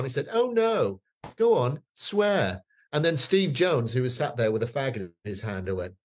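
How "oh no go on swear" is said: goading presenter. Retold or imitated. Presenter goading in retold story.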